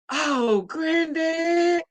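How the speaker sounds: put-on high, soft child sympathy; lightly voiced grandchild; grandkid voice. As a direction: coo like a small grandchild. Grandchildren quoted.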